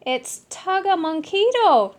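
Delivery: high, loud cheering; rally the crowd; gleeful cheer. Acted. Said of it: "cheered the Chimichangos".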